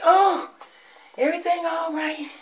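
sky-high mocking falsetto; mocking the news. Fed-up vlogger mocking what the news tells people, sky-high falsetto sarcasm. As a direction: lampoon the cheery TV line in a squeaky high voice. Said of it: "listening to the news and saying".

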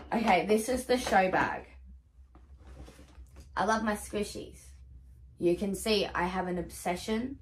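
ordinary talk, nothing marked

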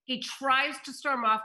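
ordinary talk, nothing marked

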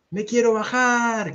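high cry with a stretched last word; shouted plea. A narrator's reading. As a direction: shout to be let off, drawing out the end. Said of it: "grita Olivia".

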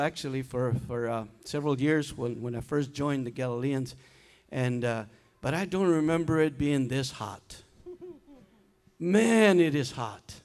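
ordinary talk, nothing marked

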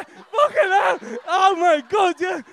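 high, loud startled outburst; startled shout at an interruption on stage. Real, not acted. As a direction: yelp as if something just made you jump. Startled by something in crowd.

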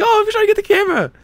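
high, fast whining plea; whiny pretend chaser. Shown as a demonstration. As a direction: squeal it like a desperate chaser. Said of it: "Be like".

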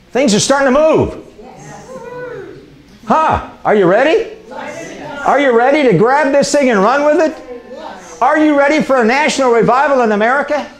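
high-pitched rallying cry to the congregation; preacher working up a crowd, pitched high; fired-up preaching. Real, not acted. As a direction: rouse the crowd with high, ringing questions.

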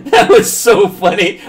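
can barely get it out for laughing; laughing hard. Real, not acted. Family member cracking up at dad's story, laughing loudly.

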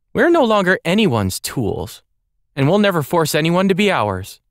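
high and loud, firm; defiant declaration. Acted. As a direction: declare it with conviction. Defiant retort to Emperor.

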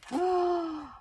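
high gasp; theatrical sucked-in breath; effortful gasp. Acted. Bodkin is jammed in the tunnel, gasping.

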